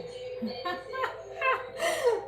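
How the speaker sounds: high, helpless burst of laughter; real laughter. Real, not acted. Streamer cracking up over her failing live stream, high helpless laugh. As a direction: cracks up at the broken livestream. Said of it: "This is so funny".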